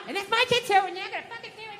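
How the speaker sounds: high, fast scolding wife. Retold or imitated. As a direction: lay down the law, pitched high. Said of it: "she just wants it".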